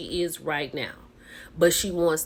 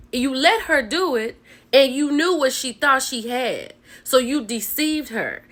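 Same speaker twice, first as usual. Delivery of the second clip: a touch of heated accusation, higher; pissed off; 5 semitones higher, 3 dB louder than usual. Retold or imitated. Host voicing Ken scolding the husband, mildly heated. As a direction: press each "you" at him, mildly angry. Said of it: "And Ken is pissed".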